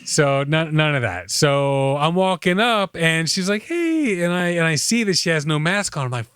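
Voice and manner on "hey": high, drawn-out mock hello; imitated greeting call. Dry cleaner owner greets an approaching customer, high imitated call. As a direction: imitate her calling out a greeting, pitched way up.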